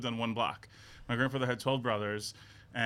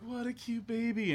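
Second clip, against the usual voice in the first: cooing stranger; 10 semitones higher, slower than usual. Retold or imitated. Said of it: "they'd be like".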